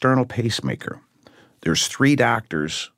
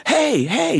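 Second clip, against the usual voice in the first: mock scream. Man retelling his wife screaming for the doctors, mild mock yell. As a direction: call out high like the wife did, only half full. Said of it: "she's screaming".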